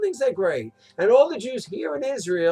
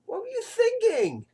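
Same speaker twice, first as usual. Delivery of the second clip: incredulous; 14 semitones higher than usual. Retold or imitated. Mocking those who stayed in Bavel.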